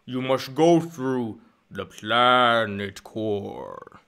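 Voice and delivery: extremely slow, slurred alien pronouncement; drawn-out put-on creature voice; Boss Nass. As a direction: drag each word out like a blubbery ruler. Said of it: "regular Boston ass".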